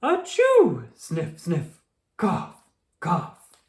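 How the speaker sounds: a huge sneeze, then sniff and cough; big sneeze. Acted. Sick pony sneezing.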